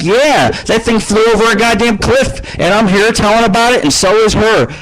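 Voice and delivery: high, excited bragging about the crash; mock bragging. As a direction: boast to the crowd, pitched well up. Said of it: "Be like".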